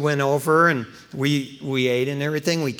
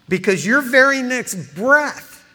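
louder, higher and slowed for weight; slow emphatic build; 9 semitones higher, 8 dB louder, slower than usual. Acted. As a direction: lean hard on each word. Preacher emphasises slowly.

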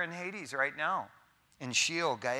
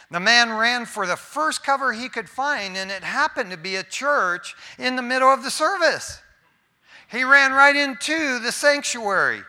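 higher, louder, building comic tale; lively storytelling; 7 semitones higher, 10 dB louder than usual. Acted. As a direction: tell the joke with rising excitement. Telling the funny devil story.

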